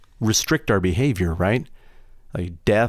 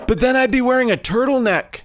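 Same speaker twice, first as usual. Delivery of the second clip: high whiny shout; 4 dB louder than usual. Pretend. Character protests loudly.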